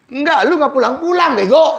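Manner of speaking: high, loud scolding; wife yelling at her husband about never coming home, loud and high; angry wife. Acted.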